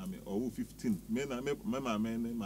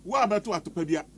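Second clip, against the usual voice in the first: louder pulpit-style declaration, rising in force; mock preaching; 8 semitones higher, 8 dB louder, faster than usual. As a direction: build it up like a preacher at full force. Mock blessing continues.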